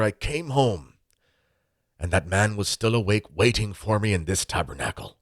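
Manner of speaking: imitating an Irish priest's accent in a slow, measured sermon; Irish priest impression. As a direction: preach it like an old Tipperary priest in his Irish accent. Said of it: "I'll do my best Irish impression".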